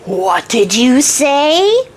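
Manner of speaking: very slow, rising disbelief; drawn-out affronted question; mock incredulous. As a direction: drag out each word in hammed-up shock. Little man balks at hearing his name.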